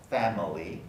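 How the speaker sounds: ordinary delivery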